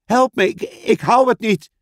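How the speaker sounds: high, panicky plea; cry for help. Acted. Lucy being dragged away, crying for help. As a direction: cry out as if losing your grip. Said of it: "riep Lucy".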